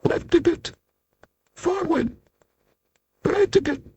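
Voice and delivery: steering from down at the shoe; tiny voice directing. Acted. Tiny Mister Small directs the narrator step by step, high and soft.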